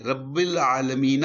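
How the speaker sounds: slow "رب العالمینہ" with final -na sounded; full vowel ending. Shown as a demonstration. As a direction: draw out "رب العالمینہ", voicing the last vowel. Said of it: "grammatically وہ عالمینہ ہے".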